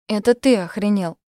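loud tearful retort; through tears. Acted. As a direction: fire back while crying. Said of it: "сквозь слёзы ответила Соня".

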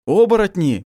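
high, loud exclamation with a smile; smiling. A narrator's reading. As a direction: greet them with pleased recognition. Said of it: "улыбнулся Басиль".

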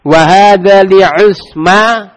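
slow, drawn-out Arabic recitation; slow teacher demo; slow model reading. Shown as a demonstration. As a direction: recite slowly for the class to repeat.

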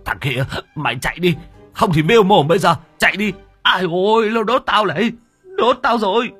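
panicked shouting. Acted. Old man stung, shouting.

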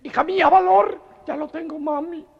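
squeaky falsetto daughter voice; high squeaky voice of the girl in the joke; mother and daughter voices. Acted.

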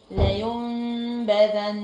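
لا ينبذن with the n turned to m and a two-count hum; very slow Quran recitation, nasal hold; iqlab with ghunna. Shown as a demonstration. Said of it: "هقولها ازاي؟".

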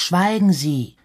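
angry demand for silence; annoyed shout. A narrator's reading. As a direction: call it out crossly. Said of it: "rief Harald ärgerlich".

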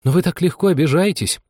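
high, pleading protest; plaintive. A narrator's reading. Anya pleading with the touchy mouse, plaintively. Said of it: "жалобно протянула Аня".

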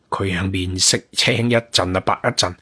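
usual speaking voice

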